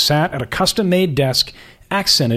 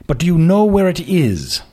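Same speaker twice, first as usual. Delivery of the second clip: put-on upper-class prep school and British accent; lofty aristocrat sizing up a young woman; aristocratic accent; 3 semitones higher than usual. Magazine boss greeting a job candidate, aristocratic accent. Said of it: "His accent was patently aristocratic".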